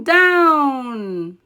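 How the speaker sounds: ordinary delivery, nothing marked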